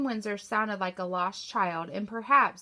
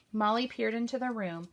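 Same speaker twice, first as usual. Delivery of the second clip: ordinary delivery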